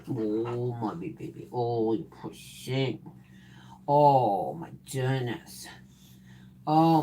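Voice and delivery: soft, slow pet sympathy; soft cooing sympathy. Real, not acted. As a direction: murmur softly to the dog. Soothing the itchy dog.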